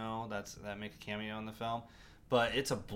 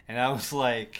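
laughing; 9 dB louder than usual. Real, not acted. Laughing while recalling crying.

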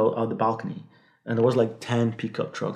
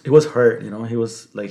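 ordinary talk

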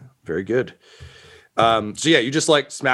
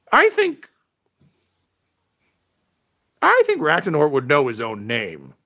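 dim henchman voice; 3 semitones higher than usual. Acted. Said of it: "and he says".

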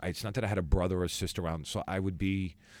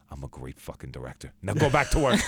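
arrogant director; 6 semitones lower, 4 dB louder than usual. Retold or imitated. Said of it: "He goes".

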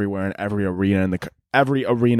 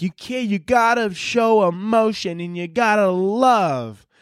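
high, drawn-out imitation of a pleading girl; nice girl; 9 semitones higher, slower than usual. Nice girl telling a money-hungry man to love, pleading. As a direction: put on a high, slow girlish plea. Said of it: "some nice girl who's like".

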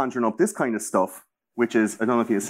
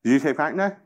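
usual speaking voice

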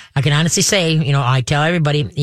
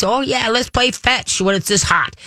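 put-on high, excited little kid; eager kid voice; 7 semitones higher than usual. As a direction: beg like an eager child. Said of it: "young dogs, young two-legged kids".

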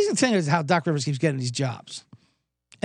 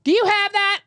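put-on drunk girl voice; 14 semitones higher, 3 dB louder than usual. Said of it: "they're like".

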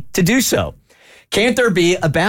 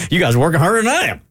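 fast, high, laughing through it; 6 semitones higher, much faster than usual. Real, not acted. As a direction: laugh the words out quickly. Joking to staff, laughing.

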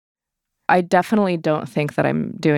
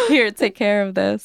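laughing mock handoff; 3 semitones higher than usual. Mock handing off baby.

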